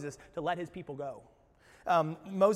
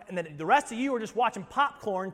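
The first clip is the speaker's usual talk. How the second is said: ordinary talk, nothing marked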